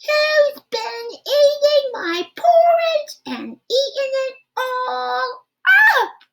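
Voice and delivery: put-on high, slow, sing-song baby wail; small wailing voice. Baby Rhino wailing over his eaten porridge, high and slow. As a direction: wail it up high like a tiny child. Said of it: "wailed Baby Rhino in his small little voice".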